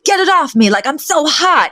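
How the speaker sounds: high-pitched mock distress; hammed-up frantic protest; frantic complaint. Acted wrestling over a stuck sweater.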